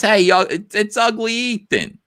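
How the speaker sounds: put-on high, loud old Jersey grandma; hammed-up grandma calling out; grandma impression. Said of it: "She goes".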